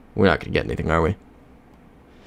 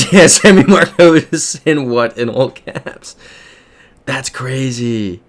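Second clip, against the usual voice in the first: amused disbelief; 5 semitones higher, 7 dB louder, slower than usual. Real, not acted. Laughing at chat's all-caps message.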